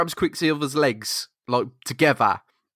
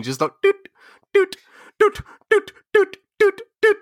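much higher, louder beeping doots; heart monitor beeps; 3 dB louder than usual. Retold or imitated. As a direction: mimic a frantic cardiogram. Imitating a cardiogram beeping.

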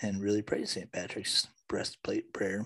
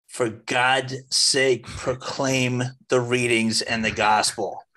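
slow, deliberate, emphatic plea; slow exasperated insistence; 3 dB louder, much slower than usual. Real, not acted. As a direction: press every word out of sheer exasperation. Exasperated plea to church readers.

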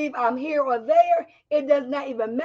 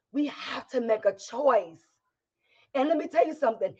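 ordinary delivery

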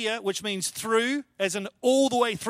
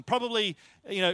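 ordinary talk

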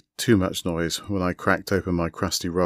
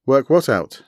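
louder, higher, a bit over-loud; too loud; 7 semitones higher, 8 dB louder than usual. Acted. Guest on a laptop screen asking the table, too loud. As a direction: overshoot the volume like a bad mic. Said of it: "his personal volume not quite right".

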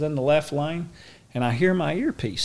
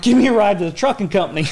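fast, laughing request; laughing, asking the buddy for a ride; 9 semitones higher, 8 dB louder, faster than usual. Retold or imitated. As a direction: spill it out fast through laughter. Laughing while retelling.

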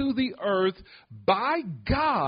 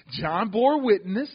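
ordinary talk, nothing marked

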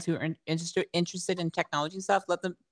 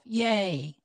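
usual speaking voice

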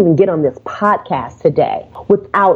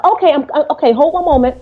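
flustered receptionist; 7 semitones higher, faster than usual. Retold or imitated. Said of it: "he was like".

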